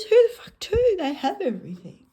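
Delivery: alien's loud disbelief. Acted. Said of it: "he goes".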